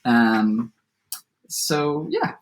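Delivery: plain reading voice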